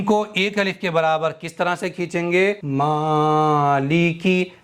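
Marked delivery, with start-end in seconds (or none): 2.6-4.5 मालिकी with the मी stretched one alif long, ल and की short; slow tajweed recitation, only the मी held; Quran recitation demo. Shown as a demonstration. Teacher reciting for students, slowly drawing out मी. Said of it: "मीम को मैंने एक अलिफ के बराबर खींचा है".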